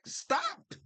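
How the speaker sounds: a sharp high yell; retold yell. Yelling at tourists walking toward a bear. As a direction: call out high to halt someone. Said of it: "I would just yell".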